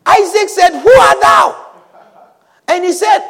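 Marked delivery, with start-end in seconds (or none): 0.7-1.6 raised, louder challenge; startled demand. Acted. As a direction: call out sharply to an unknown visitor. Isaac startled, demanding who is there.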